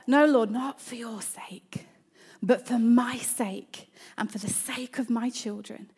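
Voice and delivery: hushed whispered prayer under the breath; a parent whispering a prayer for patience. Shown as a demonstration. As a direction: whisper it so the kids can't hear. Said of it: "it's a mutter under your breath".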